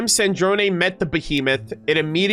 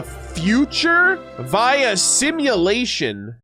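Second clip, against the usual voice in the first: drawn-out, swooping surprise on each word; amazed disbelief; 4 semitones higher, much slower than usual. Real, not acted. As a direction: read it slowly in rising astonishment. Amazed reading of a revelation.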